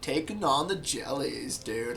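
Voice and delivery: laid-back surfer drawl, slow; surfer dude. Retold or imitated. A chill sea turtle praising the jellyfish, in a slow surfer drawl. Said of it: "I love the turtle".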